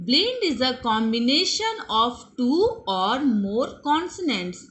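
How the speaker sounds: slow, word-by-word English definition; slow dictation. Shown as a demonstration. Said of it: "teacher dictates blend definition".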